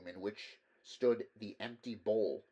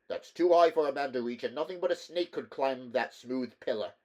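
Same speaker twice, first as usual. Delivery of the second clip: louder, higher sharp rebuke; curt snap; 3 semitones higher, 7 dB louder than usual. Acted. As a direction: snap it out, impatient. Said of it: "snapped Demetrio".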